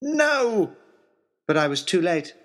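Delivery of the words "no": shouting no. Acted. Trying to stop the gunshot.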